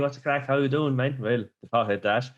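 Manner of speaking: ordinary talk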